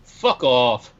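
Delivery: put-on quoting her with an English accent; imitated English accent. Guest quotes female acquaintance from England, imitated English accent. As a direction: put on a British woman's favorite phrase. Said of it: "it's got that English accent".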